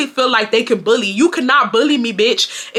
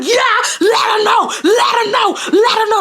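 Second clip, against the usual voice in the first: retold scream; 9 semitones higher than usual. Charged-up daughter screaming at the family, high mock yell. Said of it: "I'm screaming at the family".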